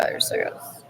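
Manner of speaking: breathy unvoiced whisper of disgust. Real, not acted. Muttering to the camera, a disgusted whisper. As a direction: hiss it under your breath in disgust.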